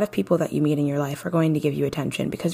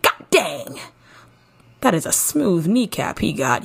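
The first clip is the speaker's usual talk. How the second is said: wowed admirer. Acted. Host imagines a woman admiring a man's smooth knee, hammed-up awe. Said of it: "don't you want her to be like".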